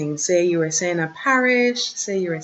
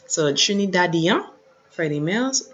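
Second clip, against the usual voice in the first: ordinary talk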